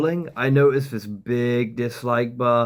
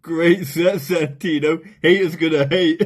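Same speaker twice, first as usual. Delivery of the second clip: mocking fanboy voice; 6 semitones higher, 4 dB louder than usual. Mocking the comedian's fans, as a gushing fanboy. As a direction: play a starstruck fan. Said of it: "his fans come in and go".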